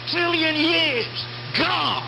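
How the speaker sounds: normal talking voice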